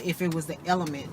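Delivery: ordinary talk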